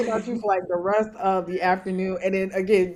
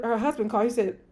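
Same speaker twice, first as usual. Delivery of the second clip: usual speaking voice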